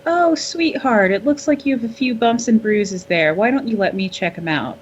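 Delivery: high-pitched mock-sweet fussing, easing down; cooing grandma. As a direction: coo over a hurt sweetheart. Jolene fussing sweetly over captive.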